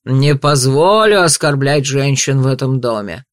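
ordinary delivery, nothing marked

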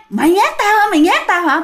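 high-pitched angry accusation, imitated; angry accusing. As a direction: bark it at her while kicking her. Husband beating wife he thinks is a ghost. (chồng tưởng vợ là ma, quát giọng cao giận dữ)

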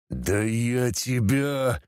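slow, drawn-out threat; anger coming to the boil, held back; starting to seethe. A narrator's reading. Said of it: "начал закипать Люцифер". (закипая от злости, с угрозой)

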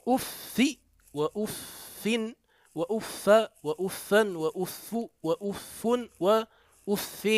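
أف said with damma, fatha, kasra in turn; eight variants of uff. Shown as a demonstration. Teacher listing the eight forms of أف. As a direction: run through the vowels of أف. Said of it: "ثماني لغات".

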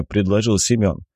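usual speaking voice

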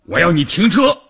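high, loud, insistent demand; angry order. A narrator's reading. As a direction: hammer the order home to the driver. Angry commander repeats his order. (气恼地高声强调命令)

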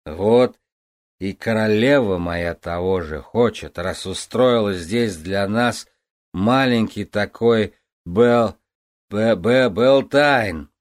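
put-on slow, stumbling drunk slur; tripping over the word; blackout drunk. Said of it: "еле ворочая языком". (пьяное заплетание языка)

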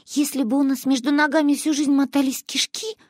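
put-on relieved girl. A narrator's reading. Manya whispering back to her friend. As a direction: reason it out like a relieved little girl.

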